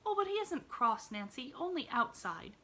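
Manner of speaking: high, quick defending protest; loyal protest. Acted. Girl defends the old man to the maid. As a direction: stick up for him quickly. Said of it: "demurred Pollyanna with quick loyalty".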